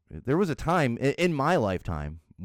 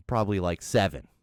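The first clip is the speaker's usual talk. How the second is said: normal talking voice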